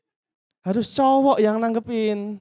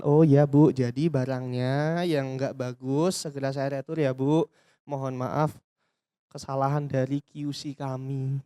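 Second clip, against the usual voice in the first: calm polite CS; 7 semitones lower, slower than usual. Shown as a demonstration. CS calming customer demo.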